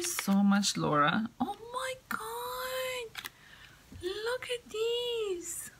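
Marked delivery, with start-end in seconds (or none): 1.4-5.7 high, soft, drawn-out squeal; slow, squeaky awe; overwhelmed delight. Real, not acted. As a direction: stretch each word in a tiny high squeal. Overwhelmed by more cat gifts.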